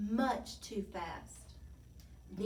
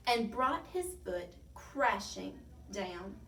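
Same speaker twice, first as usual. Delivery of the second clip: ordinary delivery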